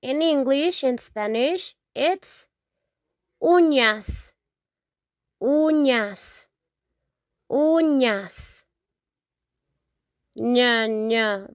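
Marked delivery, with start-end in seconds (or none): 3.4-8.6 "uñas" with the "ny" sound: OO-nyas; Spanish ñ demo. Shown as a demonstration. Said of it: "Remember the sound". 10.3-11.5 "Ña" said as "nya", tongue pressed to the roof of the mouth; ñ sound demo. Shown as a demonstration. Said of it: "Remember the sound".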